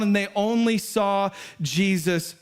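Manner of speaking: ordinary talk, nothing marked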